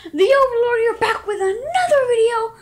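loud, high energetic YouTube greeting; loud intro. Real, not acted. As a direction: shout the channel intro.

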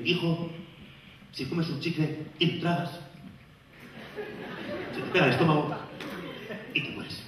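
a lightly voiced cautioning mother; soft warning mother. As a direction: hush it like a mother scaring her son.

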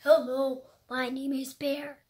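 ordinary delivery

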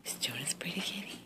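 soft whispered pet question. Real, not acted. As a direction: whisper it tenderly to the cat. Whispering to the cat.

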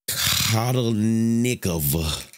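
Kotelnikov sounded out very slowly, syllable by syllable; careful attempt at a name. Shown as a demonstration. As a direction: creator reading a tricky plugin name to viewers, slow and careful. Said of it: "I'm finna butcher this name".

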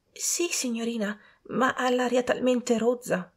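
high, hushed hesitant servant; timid servant. A narrator's reading. Servant objecting to the lady, timid hushed voice.

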